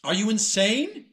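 high, loud shocked outburst; outraged disbelief. Acted. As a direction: blurt it out, appalled. God shocked at the request.

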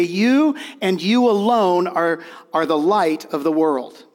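"You" and "you alone" leaned on, slow; slow, weighty "you and you alone"; stress on you. Shown as a demonstration. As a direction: hit each "you" hard and slow. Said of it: "could literally be translated".

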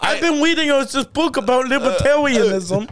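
high, loud put-on child voice; protesting little kid. Third-grader arguing with teacher about rules, mock child voice. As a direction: play an indignant child who can't say R.

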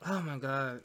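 winded from laughing; catching breath after laughter; after laughing hard. Real, not acted. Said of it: "My stomach hurts from laughing at y'all".

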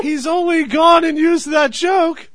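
high, squeaky puppet voice of Phil; Phil's voice. Acted. Host slips into Phil's voice to mock the joke, high and squeaky. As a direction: pitch way up into Phil's cartoon voice. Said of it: "And that's Phil's voice talent".

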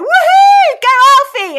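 loud, high-pitched whoop; excited cheer. Retold or imitated. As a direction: cheer him on at full voice. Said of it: "we were all so excited".

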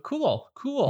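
high sarcastic cool. Retold or imitated. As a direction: squeak out a fake cool. Mock cool, pitch jumps up.

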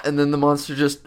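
normal talking voice

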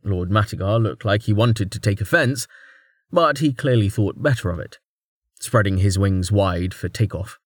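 usual speaking voice

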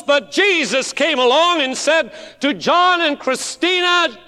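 high, loud, slow preaching build; preacher swelling to a cry; full-force preaching. Acted. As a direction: thunder it out from the pulpit.

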